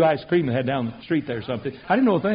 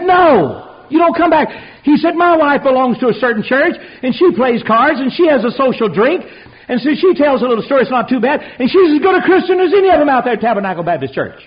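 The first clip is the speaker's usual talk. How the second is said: high, loud indignant retelling; the boss gets heated defending his wife; worked-up boss; 6 semitones higher, 5 dB louder than usual. Retold or imitated. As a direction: boss arguing back at the young announcer, heated. Boss defends his wife's church.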